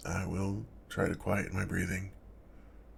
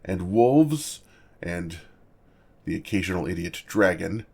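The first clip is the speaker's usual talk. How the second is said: booming dragon boast; 10 dB louder than usual. Acted. Dragon boasting about dangers.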